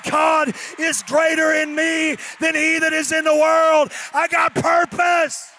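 high, loud and slowing into a proclamation; preaching at full force. Acted. Pastor calling the church to a spirit of boldness.

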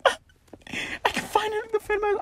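high, laughing delight; laughing squeal. Real, not acted. Gamer gleefully laughing about finally being able to defend himself.

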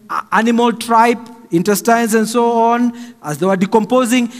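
ordinary talk

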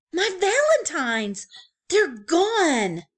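high, loud dismayed cry. Acted. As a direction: cry out in panic. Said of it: "cried Franklin".

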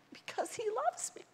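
hushed whisper. Real, not acted. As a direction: breathe it as a secret. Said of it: "whispered aside".

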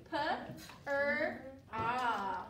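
"P, er, ah" each sound separate with pauses; "P", "er", "ah" as separate phonemes. Shown as a demonstration. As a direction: segment the sounds one by one. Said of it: "You ready?".

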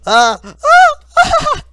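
loud high panicked cries; panicked screaming. Real, not acted. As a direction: cry out in panic. Caught by two teams.

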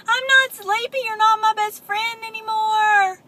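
high, loud tantrum scream; high loud pigeon tantrum. Acted. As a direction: scream it like a toddler throwing a fit. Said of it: "and he screamed".

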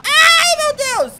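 high, loud shriek of alarm; startled cry. Real, not acted. Player caught off guard on the road, high loud shriek. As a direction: shriek as something jumps out. Sudden scare while driving.

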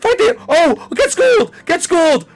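high, loud battle shrieks; frantic yelling. Real, not acted. Gamer fighting the animatronic, frantic triumphant yells. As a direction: scream through the fight.

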